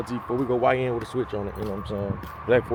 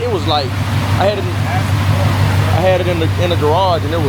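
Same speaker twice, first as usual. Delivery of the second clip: excited shouting; 9 semitones higher, 9 dB louder than usual. Real, not acted. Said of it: "I almost start crying".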